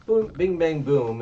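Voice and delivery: high, loud boom bing bang boom; cartoon fight noises; hit sound effects. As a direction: punch out each comic-book hit.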